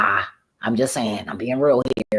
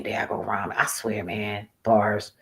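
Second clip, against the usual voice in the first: ordinary delivery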